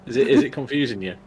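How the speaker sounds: normal talking voice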